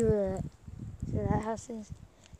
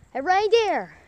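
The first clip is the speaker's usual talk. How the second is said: loud call across the field; yelling; 8 semitones higher, 9 dB louder than usual. Real, not acted. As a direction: shout it out to the reindeer. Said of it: "Well, I shouldn't yell".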